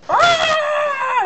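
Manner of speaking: high loud shriek to scare chickens; scary bat shriek. Retold or imitated. Husband running at the chickens, shrieking. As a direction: screech like a giant bat. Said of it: "bat going".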